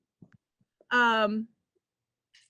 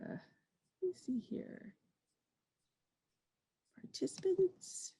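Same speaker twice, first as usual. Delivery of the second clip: near-whispered talking to oneself; muttering to self; 7 semitones higher, 11 dB quieter, slower than usual. Real, not acted. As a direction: murmur it under your breath while searching. Muttering to self while searching.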